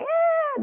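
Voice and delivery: a squeaky high "eh" of doubt; mock reluctant "eh", way up high; high dismissive eh. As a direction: toss off a high-pitched "eh". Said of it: "I catch myself being like".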